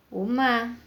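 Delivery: usual speaking voice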